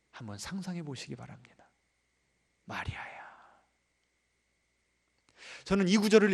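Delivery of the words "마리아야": 마리아야 whispered, soft and aching; longing whisper. Shown as a demonstration. Preacher shows Jesus calling Mary with aching tenderness, whispered. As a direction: breathe the name with longing. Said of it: "어떤 어조로 여러분에게 여러분의 이름을 부르실지를".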